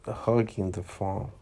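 ordinary delivery

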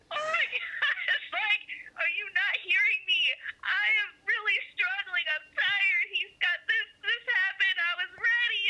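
high-pitched retold meltdown; imitating your own tearful outburst; mock bawling. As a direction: rant in a high, hammed-up wail. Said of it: "just going off and just bawling".

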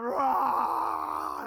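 loud playful roar like a lion. Retold or imitated. Pastor playing the lion for his kids at bedtime, loud playful roar. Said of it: "I'm growling like a lion".

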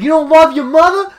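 loud, high indignant father impression; Peter Boyle. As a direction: bellow it like an outraged sitcom dad. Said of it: "Then Peter Boyle's like".

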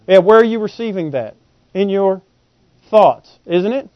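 normal talking voice

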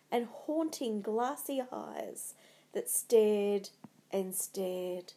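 plain reading voice

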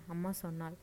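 ordinary delivery, nothing marked

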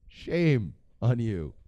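slow, stretched SHAME, pause, then "on you"; drawn-out mock scolding. Comedian chides disloyal ex-presidents, slow mock scolding. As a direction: draw out the reproach word by word.

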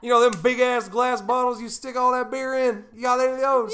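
hammed-up southern accent. Prank call voice continued.

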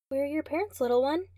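put-on high, sing-song voice talking down to a kid; a nurse who thinks she is speaking to a little kid; condescending nurse. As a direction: coo at the child like a sweet nurse.

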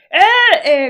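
a big brother spotting a cricket; excited kid shout. Retold or imitated. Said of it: "y el hermano grande".

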